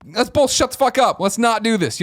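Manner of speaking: high-pitched, firm appeal; retold plea to break up a fight; exasperated intervention. Passenger stepping into an argument on the train, exasperated.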